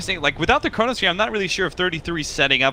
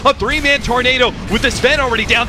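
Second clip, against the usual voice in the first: raised, fast fight call; excited play-by-play; 8 semitones higher, 4 dB louder than usual. Acted. Caster calling the fight, raised and excited. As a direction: keep the hype as heroes fall. Casting a teamfight.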